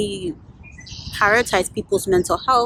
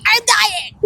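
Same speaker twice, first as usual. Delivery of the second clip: mock despair; 12 semitones higher, 4 dB louder than usual. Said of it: "trying to hold back tears".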